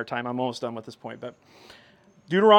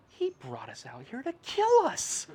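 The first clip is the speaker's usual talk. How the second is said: whining Israelites; 8 semitones higher, 4 dB quieter than usual. Retold or imitated. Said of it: "we're like".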